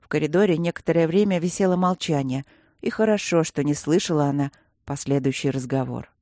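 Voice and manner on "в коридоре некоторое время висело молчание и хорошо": ordinary delivery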